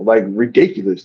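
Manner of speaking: ordinary delivery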